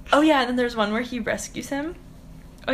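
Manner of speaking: usual speaking voice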